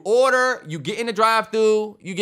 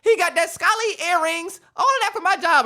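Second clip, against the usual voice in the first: mock store clerk; 10 semitones higher than usual. Imitating the beauty supply clerk, high mocking voice. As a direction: call out his purchases like the shop girl.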